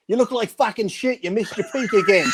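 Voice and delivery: high mock yell across the room; yelling insult. Bodybuilder taunting a rival across the backstage room, high mock yell. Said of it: "Titus yells back".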